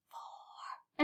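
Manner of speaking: breathy unvoiced number; whispered count. Shown as a demonstration. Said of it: "but in a whisper voice now".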